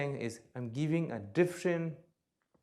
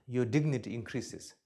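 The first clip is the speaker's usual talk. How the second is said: ordinary talk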